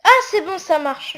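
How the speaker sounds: sudden high, loud "ah" of relief; real surprised relief; relieved surprise. Real, not acted. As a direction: let it burst out as it finally works. Test suddenly works.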